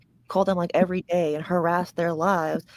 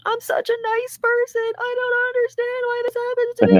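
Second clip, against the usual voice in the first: high, fake whiny sob, much higher; mock crying; 3 dB louder than usual. Mocking a streamer who plays the victim, high fake sob. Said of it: "crying, saying, like".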